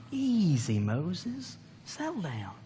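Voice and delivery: mock soothing to an angry brother; soothing calm-down. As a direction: talk a furious man down softly. Aaron calming Moses.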